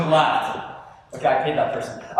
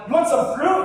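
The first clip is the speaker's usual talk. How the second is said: mock mom; 8 semitones higher than usual. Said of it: "your mom's like".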